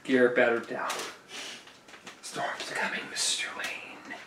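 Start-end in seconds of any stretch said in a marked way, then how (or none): 2.2-4.2 breathy spooky whisper; ominous whisper. Shown as a demonstration. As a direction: whisper it like a warning. Same line repeated, whispered.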